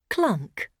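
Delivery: usual speaking voice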